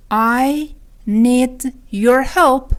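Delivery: very slow, every word separate. Shown as a demonstration. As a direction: leave gaps in "I ... need ... your help". Lesson repeats it word by word. (خیلی شمرده، کلمه به کلمه)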